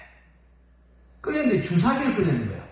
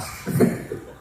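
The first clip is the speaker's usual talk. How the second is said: laughing. Real, not acted. Punchline about blood drawn.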